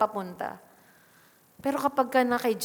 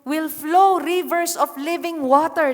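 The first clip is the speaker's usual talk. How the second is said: emphatic proclaiming; 5 semitones higher, 7 dB louder than usual. Acted. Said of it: "ang sabi niya dito".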